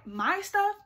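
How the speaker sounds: high, incredulous question; acted shock at a friend. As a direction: pitch it up in disbelief. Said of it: "I'm in shock".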